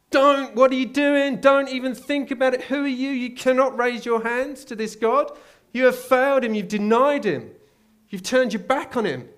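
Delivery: very high, loud furious attack; accusing voice. Retold or imitated. Satan shouting down a worshipper, high furious yell. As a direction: rage at the worshipper to shame them.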